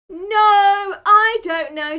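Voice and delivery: loud, high, hasty denial. A narrator's reading. Peter denying Jesus to the girl, loud flustered denial. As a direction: blurt the denial out.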